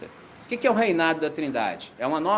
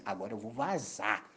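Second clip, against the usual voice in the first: low, much softer private thought; inner thought; 7 semitones lower, 11 dB quieter than usual. Retold or imitated. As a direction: say it under your breath, planning the exit. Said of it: "pensei assim".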